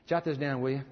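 ordinary talk

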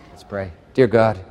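ordinary talk

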